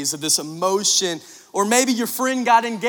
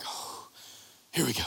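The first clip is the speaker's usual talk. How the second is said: much softer, lower resigned breath; bracing murmur; 8 semitones lower, 15 dB quieter than usual. Retold or imitated. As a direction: brace yourself under your breath. Bride appears, groom braces himself.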